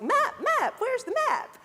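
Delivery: very high cartoon kid voice; squeaky cartoon explorer calling out; Dora the Explorer voice. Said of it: "it's almost like".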